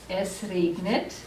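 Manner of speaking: slow, careful "Es regnet" with "regnet" drawn out; slow model reading. Shown as a demonstration. Teacher to class, slowly modelling the sentence. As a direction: say "Es regnet" slowly for students to copy.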